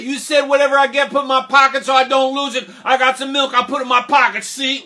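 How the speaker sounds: loud, high, rushed protest; defensive boy Jack. Acted. Jack explaining the milk to mother, defensive. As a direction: blurt out your excuse at speed.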